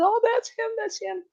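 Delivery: high, delighted cry of recognition; excited recognition. Retold or imitated. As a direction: squeal it out as you recognize him. Woman recognizes her husband.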